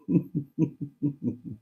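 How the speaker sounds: quiet run of giggles; laughing. Real, not acted. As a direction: chuckle softly at your own silly attempt. Laughs at own accent attempt.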